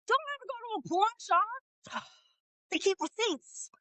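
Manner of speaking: high soft mock warning; playful hushed caution. Mock advice.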